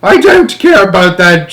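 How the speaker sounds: loud, high-pitched shout; grandma's shout. A narrator's reading. Grandma refusing to listen in the elevator, shouting. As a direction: yell it high as Grandma Josephine. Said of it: "shouted Grandma Josephine".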